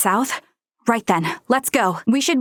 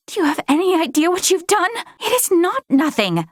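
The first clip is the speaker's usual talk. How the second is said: indignant scolding; 8 semitones higher than usual. Acted. Scolding Midas over stolen machine.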